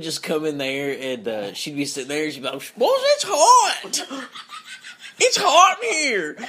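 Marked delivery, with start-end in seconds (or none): 2.8-6.4 high, drawn-out country holler; country aunt. Retold or imitated. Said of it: "she's very country".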